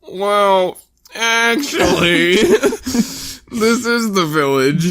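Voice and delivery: high, very slow creaky elder voice; stretched-out high granny frog; old lady frog. Retold or imitated. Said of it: "the old lady frog".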